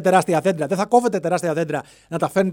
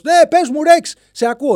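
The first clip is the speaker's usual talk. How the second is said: dinosaur shouting back; 10 semitones higher, 7 dB louder than usual. Acted. Dinosaur answering the call.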